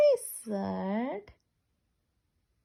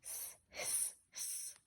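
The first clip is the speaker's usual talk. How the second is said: long breathy snake hiss; 19 dB quieter than usual. Pretend. Imitating the snake.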